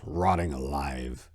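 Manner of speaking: ordinary delivery, nothing marked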